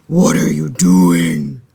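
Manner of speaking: deep, slow bear growl; low gruff bear voice. A narrator's reading. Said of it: "they growled".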